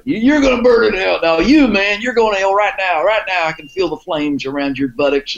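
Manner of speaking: goading the audience as a fake preacher, high and theatrical; mock hellfire preacher. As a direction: play the fire-and-brimstone preacher to rile the audience.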